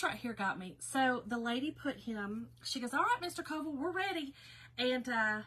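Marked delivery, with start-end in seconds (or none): 2.9-4.3 lightly voiced attendant, higher pitch; attendant calling. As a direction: mimic a helper announcing readiness, lifted voice. Retelling the wheelchair attendant's call.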